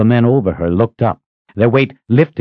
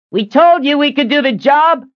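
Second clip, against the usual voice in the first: high, raised proud shout; calling back; 3 dB louder than usual. A narrator's reading. Rescuer answering fellow rider at a gallop, calling back. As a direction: shout it cheerfully across the hoofbeats.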